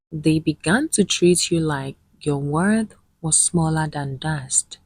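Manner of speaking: usual speaking voice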